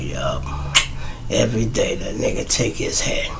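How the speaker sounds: hushed whisper. Retold or imitated. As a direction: breathe it almost silently. Friend whispers reply.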